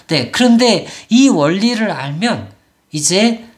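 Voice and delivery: ordinary talk, nothing marked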